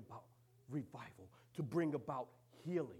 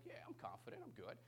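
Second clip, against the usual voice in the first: faked confidence; 4 semitones lower, 8 dB quieter than usual.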